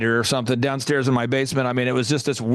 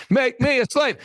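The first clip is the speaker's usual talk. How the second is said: pleading; 7 semitones higher than usual. Retold or imitated. Begging boss again.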